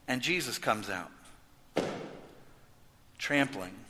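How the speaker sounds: normal talking voice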